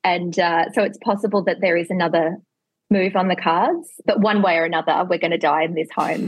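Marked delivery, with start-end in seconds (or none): none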